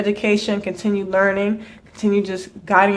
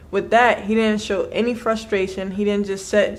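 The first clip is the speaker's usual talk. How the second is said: ordinary delivery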